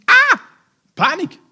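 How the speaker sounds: high, loud yelp of sudden panic; mock panicked shriek. Servant realises no way out.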